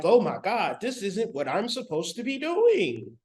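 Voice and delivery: ordinary talk, nothing marked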